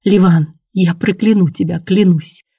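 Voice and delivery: plain reading voice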